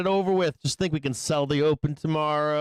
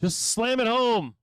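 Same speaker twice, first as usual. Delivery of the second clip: coaxing yell at chart; 2 semitones higher than usual. Real, not acted. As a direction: egg the chart on like a tease. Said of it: "yelling at the fucking bars".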